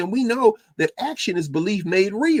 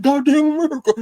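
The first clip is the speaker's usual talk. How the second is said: loud, high preacher's cry of Goddamn America; shouted quote; 11 semitones higher, 6 dB louder than usual. Retold or imitated. Quoting Jeremiah Wright's sermon, high and loud. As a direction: call it out loud and high like from the pulpit. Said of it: "he said".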